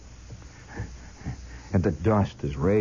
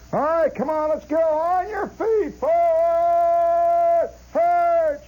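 much higher, slow, louder drawn-out command to march; sergeant calling march; 5 dB louder, much slower than usual. Retold or imitated. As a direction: bellow the order to move out, stretched long. Sergeant calling march after break.

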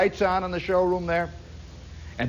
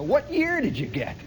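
higher, puzzled dad asking again; puzzled father; 7 semitones higher than usual. Retold or imitated. Father pressing his son about the car year, puzzled and higher. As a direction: repeat the question as a bewildered father. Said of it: "He never even thought of new ones".

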